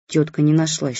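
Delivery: usual speaking voice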